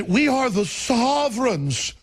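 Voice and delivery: high, slow, grandiose mock voice; pompous George Will. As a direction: lord it over the voters. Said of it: "He said".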